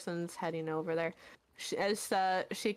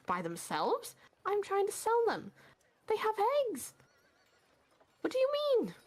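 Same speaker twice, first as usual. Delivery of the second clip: put-on much higher, frantic old woman voice; panicked shopkeeper woman; 8 semitones higher than usual. Woman protesting over her chickens, high frantic squeal. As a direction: shriek about her chickens.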